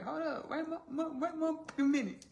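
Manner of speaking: high-pitched sassy interruption; mock indignant. Elizabeth halts Darcy's insult to her family, mock indignant. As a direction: cut in high, stammering in protest.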